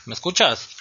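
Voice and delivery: choppy phone line; breaking up on a bad connection; call dropping out. Real, not acted. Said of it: "yo no te escucho bien".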